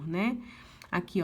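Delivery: normal talking voice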